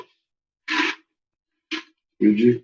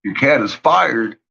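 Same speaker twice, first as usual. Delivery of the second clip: ordinary talk, nothing marked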